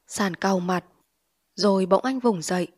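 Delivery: usual speaking voice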